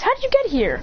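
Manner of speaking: put-on surprised kid voice. Boruto startled when Mitsuki suddenly appears, high surprised boy voice.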